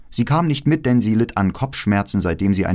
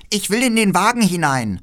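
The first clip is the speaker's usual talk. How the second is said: viel höher, fordernd; trotziges Räubermädchen; 11 semitones higher, 4 dB louder than usual. Acted. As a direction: quengelnd wie ein verwöhntes Kind. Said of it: "sagte das kleine Räubermädchen". (Räubermädchen verlangt Gerda zum Spielen: hoch und fordernd)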